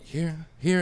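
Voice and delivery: high, eager little elf offering a gift. Retold or imitated. Elves pressing gifts on the tripping narrator, lightly voiced. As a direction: push it at him like an excited elf. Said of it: "they were like".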